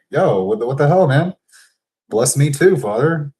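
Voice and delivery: high, loud indignant dude voice; hammed-up what the hell, man; mock street Esau. Esau as a modern guy protesting to his father, indignant. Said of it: "he's like".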